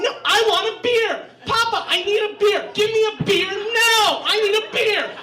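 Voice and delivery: lightly voiced kid tantrum; toddler tantrum. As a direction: squeal it like a two-year-old in a tantrum. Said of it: "starts freaking out and yelling".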